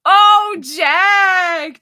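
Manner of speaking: loud delighted call across the room. Real, not acted. Host calling out a viewer's name in delight. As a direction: shout the name in glee.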